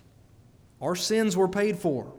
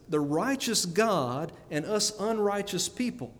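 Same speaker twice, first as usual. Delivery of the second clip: ordinary talk, nothing marked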